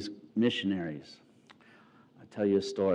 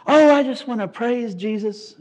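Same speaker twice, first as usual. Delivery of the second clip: higher, louder, syrupy church-lady voice; gushing missionary wife; 12 semitones higher, 8 dB louder than usual. Retold or imitated. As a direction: gush like an overjoyed missionary wife. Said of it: "she goes".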